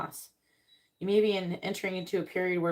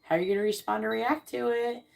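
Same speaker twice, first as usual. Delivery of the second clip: teasing quiz-master voice; the universe asking; 4 semitones higher than usual. Retold or imitated. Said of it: "you're like".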